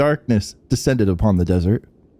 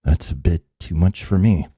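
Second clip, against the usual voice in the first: lower, apologetic and fading; lower decrepit old man; 6 semitones lower than usual. Acted. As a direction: back off meekly. Stranger demurs, old man.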